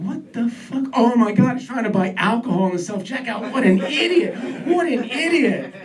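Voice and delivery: high, scornful comic outburst; mocking disbelief. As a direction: jeer at a hopeless idiot. Mock contempt for checkout customer.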